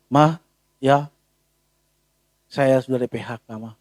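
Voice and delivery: low, soft, hesitant husband breaking bad news; fearful confession. Husband timidly telling his wife he lost his job, low and soft.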